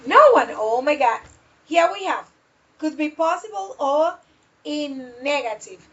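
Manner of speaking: ordinary delivery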